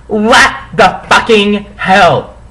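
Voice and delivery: high, slow angry question. Acted. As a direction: demand it slowly and angrily. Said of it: "I asked angrily".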